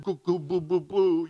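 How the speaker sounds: put-on cooing baby talk to an infant. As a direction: coo at a baby like a doting dad. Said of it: "They say silly things without shame".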